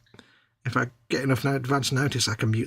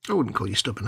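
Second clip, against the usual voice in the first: ordinary talk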